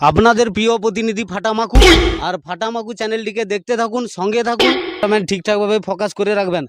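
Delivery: hammed-up TV reporter voice; parody news anchor. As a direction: announce it like a local news anchor. Reporter sign-off to viewers.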